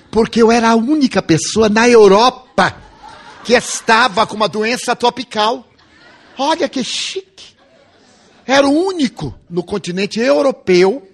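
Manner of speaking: bursting with amused pride; laughing with delight. Real, not acted. Delighted at having tropical disease.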